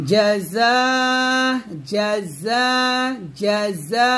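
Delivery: জাযা with a true জ from mid-tongue and a buzzing য; clear jim. Shown as a demonstration. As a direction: separate the জ and the য in জাযা. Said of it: "জিমটা জিহ্বার মধ্যখান". (জিম ও ঝা আলাদা করে উচ্চারণ)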